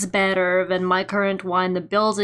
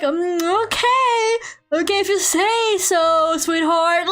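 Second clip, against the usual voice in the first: high, patronising sing-song; condescending doubt; 7 semitones higher, slower than usual. Retold or imitated. Agency doubting the job on the phone, patronising sing-song. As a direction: talk down to her sweetly.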